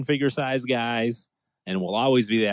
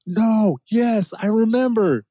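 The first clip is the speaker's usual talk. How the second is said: 1 surprised gasp; 11 semitones higher, 3 dB louder, slower than usual. Retold or imitated. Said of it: "I was like".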